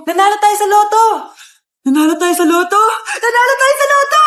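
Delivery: excited shouting. Acted. Child announcing lottery win. (sumisigaw sa tuwa, papataas)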